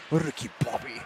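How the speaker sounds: mock French accent. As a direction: echo the French racer quietly through a laugh. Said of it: "very French".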